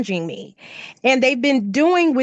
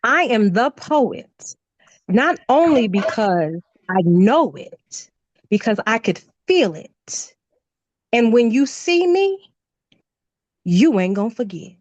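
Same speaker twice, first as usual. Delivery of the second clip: spoken-word poem. Real, not acted. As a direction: declaim it in measured phrases with pauses. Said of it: "complete this sentence for me".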